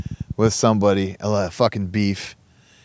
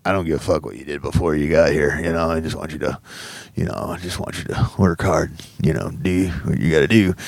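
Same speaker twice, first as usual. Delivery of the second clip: lower, blunt chief voice; gruff master chief; 6 semitones lower than usual. Retold or imitated. Master Chief telling the newcomer to work hard, in a lower, blunt voice. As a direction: lay it down like a no-nonsense boss.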